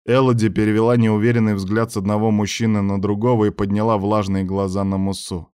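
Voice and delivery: ordinary delivery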